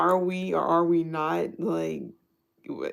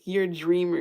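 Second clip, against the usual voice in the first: mock New York; 6 dB louder than usual. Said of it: "New York was like".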